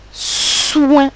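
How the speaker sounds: "soin" with a long hissed s gliding into ouin; sounds drawn out. Shown as a demonstration. Teacher giving the last syllable to decode, "soin" drawn out. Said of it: "Pour la dernière syllabe".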